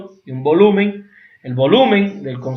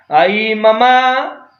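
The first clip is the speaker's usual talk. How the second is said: drawn-out calling mamá; 5 semitones higher, much slower than usual. Shown as a demonstration. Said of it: "dependiendo del tono que diga mamá".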